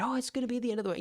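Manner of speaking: high mocking alarm; left-wing critics wailing, high mock voice; mock doom. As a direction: fake panic, hammed-up. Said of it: "and they said".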